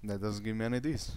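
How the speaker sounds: normal talking voice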